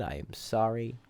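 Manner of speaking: whispered mock apology; barely voiced, very soft apology; hushed apology. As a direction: drop to a tiny, sheepish whisper. Mock apology for bad accent.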